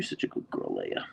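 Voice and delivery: hushed whisper to the dog. Real, not acted. Owner whispering praise to her husky. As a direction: whisper it softly.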